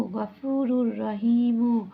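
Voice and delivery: slow recitation of গাফুরুর রহিমু, the র merged and held; recited with idgham. Shown as a demonstration. Teacher shows students how to blend গাফুরুর রহিমু. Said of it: "এরপর হবে কি".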